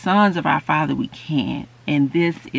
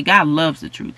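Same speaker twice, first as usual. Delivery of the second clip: ordinary talk, nothing marked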